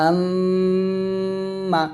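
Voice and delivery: আম্মা with the doubled m hummed long through the nose; nasal ghunnah. Shown as a demonstration. Teacher gives ghunnah example, আম্মা long and nasal. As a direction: hold the m of আম্মা steady in the nose, then release. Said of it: "নাকের বাসি হইতে গুন্নাহ".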